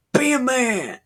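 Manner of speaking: rally the troops with a mock war cry; rallying shout. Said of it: "the dude's like".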